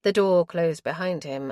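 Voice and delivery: plain reading voice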